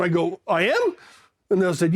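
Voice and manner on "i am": high, rising disbelief; mock-innocent question; retold surprise. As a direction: act out a clueless double take. He learns he is Charlie Brown.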